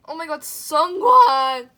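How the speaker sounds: loud, slow, drawn-out scream of joy; excited scream. Real, not acted. Fan pulling Sungwon's card, screaming his name.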